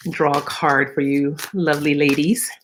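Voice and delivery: normal talking voice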